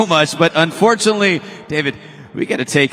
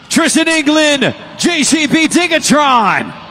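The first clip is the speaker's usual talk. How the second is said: excited announcer reveal; 5 semitones higher than usual. Real, not acted. Announcer revealing the overall champion to the crowd, hyped. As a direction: boom the champion's name.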